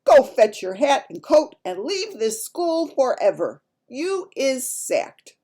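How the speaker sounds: loud, high, outraged bellow; head teacher's shout. A narrator's reading. Head teacher rousing a sleeping teacher to sack her, furious shout. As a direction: shout it as a scolding head teacher, drawing out key words. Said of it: "he shouts".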